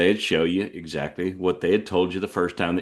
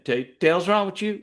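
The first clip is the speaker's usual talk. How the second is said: higher-pitched Texas farmer's reprimand; old farmer; 9 semitones higher than usual. Acted. Farmer walking in on a mistake, scolding old farmer. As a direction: run it together like a scolding rancher. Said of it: "old farmer would come in".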